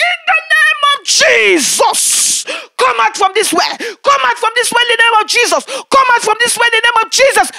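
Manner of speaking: loud, high exorcism shout; fervent retold command; commanding prayer. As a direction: drive the spirit out with every breath. Casting the spirit out of well.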